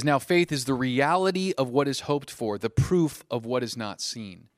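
loud, declarative Bible verse; projected pulpit reading; scripture reading. Acted. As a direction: proclaim the verse to a congregation. Reads scripture aloud.

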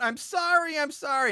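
high pleading imitation of a terrified scream; mock scream. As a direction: beg mockingly high, a hint of panic. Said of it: "Sam screams out".

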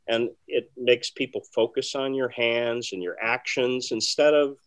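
ordinary talk

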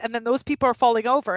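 usual speaking voice

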